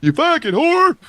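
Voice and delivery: high, loud mock holler; mimicked yell. Friend echoing the neighbor's insult for laughs, mock yell.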